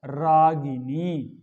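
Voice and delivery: रागिनी very slow, clear गि and dental नी; correct pronunciation. Shown as a demonstration. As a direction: stretch every syllable of रागिनी. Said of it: "शुद्ध है ना इनी प्रत्यय". (रागिनी का शुद्ध उच्चारण, खींचकर)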